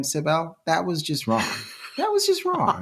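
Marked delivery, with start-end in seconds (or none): 2.0-2.8 squeaky-high mock dismay; scandalised, pitched way up; mock outrage. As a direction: repeat it in a comic falsetto. Repeats mock scolding, much higher.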